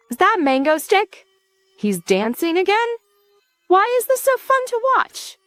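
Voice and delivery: high-pitched excited livestream viewer; chat going wild. A narrator's reading. Livestream chat reacts to the villain's arrival, going wild. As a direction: exclaim it high, climbing with excitement. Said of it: "The chat goes wild".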